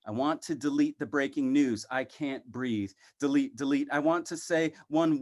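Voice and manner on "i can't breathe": soft, lower, almost breathless; a dying plea, softly; hushed refrain. Acted. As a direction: let it sink to a hush. Poem refrain, voice sinking.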